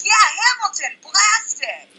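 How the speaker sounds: high, whooping mock holler; acted calling out. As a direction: holler it like an excited stranger at a car window. Man calling from car window.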